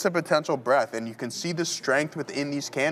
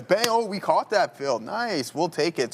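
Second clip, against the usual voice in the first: sudden high-pitched delight; excited; 6 semitones higher, slower than usual. Real, not acted. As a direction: celebrate the fill, bright and quick. Trader's order just got filled.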